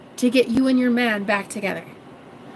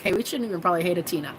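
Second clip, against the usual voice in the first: ordinary delivery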